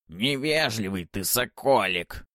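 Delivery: высокий медленный шамкающий голос ведьмы; прошамкай по-старушечьи; шамкающая Баба-Яга. Acted. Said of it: "прошамкала Баба-Яга". (шамкающий голос старой ведьмы)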